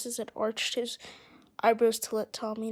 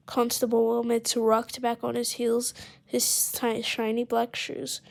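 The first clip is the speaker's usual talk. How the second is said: ordinary delivery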